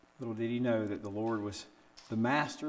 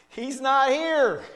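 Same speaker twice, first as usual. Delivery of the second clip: high, loud, slow and delighted; joyful announcement; 12 semitones higher, 10 dB louder, much slower than usual. Acted. Angel telling the women at the tomb, high and joyful. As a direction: proclaim it with joyful wonder.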